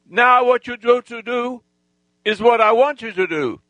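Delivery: high, sustained commanding tone; re-enacted high, emphatic order; insistent command. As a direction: order him as if casting a spell. Retold hypnotic command to gang leader.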